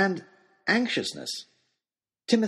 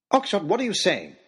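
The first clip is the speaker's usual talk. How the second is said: higher, alarmed challenge; blurted out; 6 semitones higher than usual. A narrator's reading. Narrator interrupts Oxshott's threat, blurting it out. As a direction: burst in, unable to hold back. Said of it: "I couldn't stop myself".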